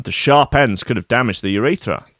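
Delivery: normal talking voice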